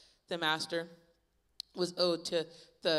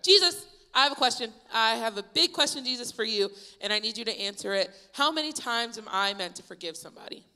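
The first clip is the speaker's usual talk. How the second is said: loud, high-pitched call for attention; raised voice, hand-shot-up eagerness; eager questioning; 4 semitones higher, 7 dB louder than usual. Acted. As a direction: blurt out a pressing question like an eager pupil. Acting out eager disciple Peter asking.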